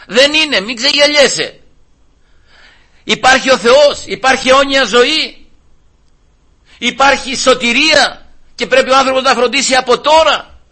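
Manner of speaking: high, emphatic proclamation; fervent preaching. Real, not acted. Preacher warning not to be deceived, fervent preaching. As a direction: proclaim it with urgent conviction.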